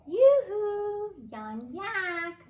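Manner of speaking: high, loud call over the fence; calling yoo-hoo. Acted. Old yak calls over the fence to the young yak. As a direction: sing it out to the neighbor. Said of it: "the wise old yak yelled over the fence".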